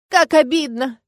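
high, loud hammed-up lament; loud complaint. A narrator's reading. Woman feigns hurt for the dragon, loud complaint. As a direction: complain loudly, playing hurt. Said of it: "громко пожаловалась я".

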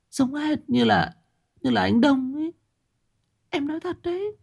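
very high, hushed, stammering wife; insistent frightened wife. A narrator's reading. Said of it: "rồi quả quyết". (giọng vợ cao, khẽ, quả quyết trong sợ hãi)

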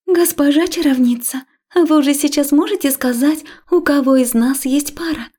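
put-on high, bright, eager girl's voice; bursting to hear the answer; eager and impatient. A narrator's reading. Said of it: "едва могла усидеть на месте, так ей не терпелось".

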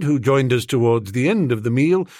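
plain reading voice

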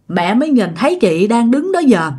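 usual speaking voice

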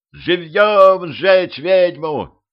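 high, slow, drawn-out crowd yell; crowd shout. Acted. Толпа на площади требует сжечь ведьму, протяжный высокий крик. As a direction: howl it out like a mob hungry for a burning. Crowd calls to burn witch.

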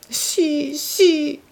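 put-on young woman crying out at the old man. A narrator's reading. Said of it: "cria-t-elle". (crier, aigu et insistant)

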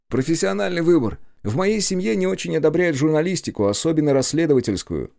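high-pitched journalist cousin; explaining cousin. A narrator's reading. Cousin explains his pseudonym.

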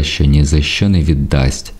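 plain reading voice

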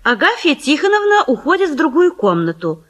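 ordinary talk, nothing marked

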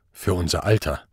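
low-voiced accused; restrained defendant; simple, sober answer. A narrator's reading. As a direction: answer plainly and humbly.

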